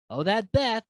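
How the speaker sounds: ordinary talk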